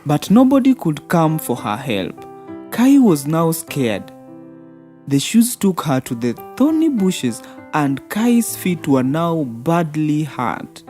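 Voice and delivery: ordinary delivery, nothing marked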